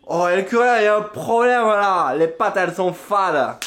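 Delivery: high, loud complaining whine; complaining girlfriend. Retold or imitated. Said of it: "elle fait".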